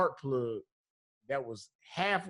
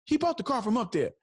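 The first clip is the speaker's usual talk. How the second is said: retold yell; 6 semitones higher than usual. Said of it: "So he yelled on the phone".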